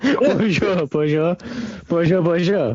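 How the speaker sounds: repeated mock French greetings; French accent. Riffing on French subtitles with co-hosts, mock French accent. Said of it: "you just put the French accent".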